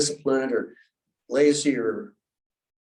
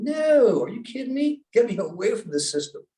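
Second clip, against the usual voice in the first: incredulous refusal; 8 semitones higher than usual. Retold or imitated. Refusing church people's invitation.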